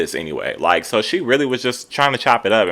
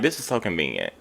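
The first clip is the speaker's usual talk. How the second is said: mock muttered jab; 2 semitones lower, 9 dB quieter than usual. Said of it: "she's literally like".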